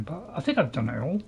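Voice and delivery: ordinary delivery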